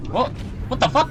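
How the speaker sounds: high, loud what the fuck; shocked cry at the screen; real startled outburst. Real, not acted. Startled by a strange enemy.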